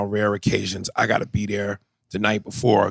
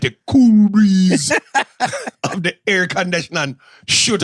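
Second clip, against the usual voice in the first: hammed-up Jamaican explanation, voice raised; mock Jamaican accent; 7 semitones higher, 3 dB louder than usual. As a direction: spin it out in showy mock Jamaican. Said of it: "I started doing my Jamaican accent".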